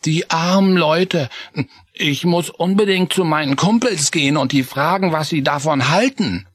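put-on high child voice, sorry then resolved; a kid who wants to act; high, eager mouse boy; high young mouse Chris.

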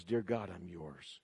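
low, slow, very soft surrender; hushed prayer. Acted. Believer giving his life to God, hushed prayer. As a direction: pray it quietly, low and unhurried.

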